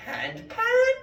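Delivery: squeaky very high question; baffling question. Retold or imitated. Philosopher to Spanish bakers, very high squeaky question. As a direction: play the confused trickster. Philosopher confusing bread makers.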